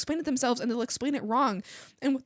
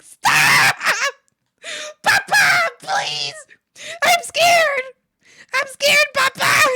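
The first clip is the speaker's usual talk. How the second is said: much higher, loud mock terror; hammed-up scared plea; 11 dB louder, much slower than usual. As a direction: cry out to papa in fake fright. Mock terror pleading with dad.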